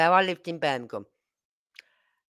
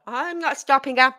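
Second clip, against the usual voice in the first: meek husband; 5 semitones higher than usual. Retold or imitated. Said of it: "my husband just backed off".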